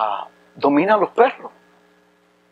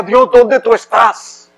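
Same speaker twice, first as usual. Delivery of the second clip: tearful prayer; 5 semitones higher, 3 dB louder, slower than usual. Retold or imitated. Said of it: "en el baño llorando le decía".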